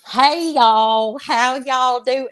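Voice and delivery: slow, hammed-up Southern country accent; mock Southern accent. UK friends mocking her country accent, hammed-up Southern drawl. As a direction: lay on a thick Southern twang the way teasing friends would. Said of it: "they even try to mock it".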